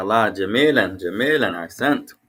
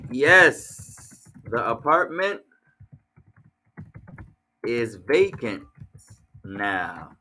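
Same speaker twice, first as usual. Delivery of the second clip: 1 slow dictation; 3 semitones lower, much slower than usual. Shown as a demonstration.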